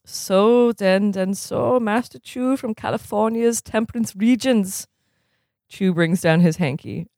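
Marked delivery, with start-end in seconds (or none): 0.1-4.9 lightly put-on German accent, formal and measured; a stern German coach scolding in a mild German accent. Stitt lecturing sluggish players at dawn drills, mild German accent. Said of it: "do the accent again".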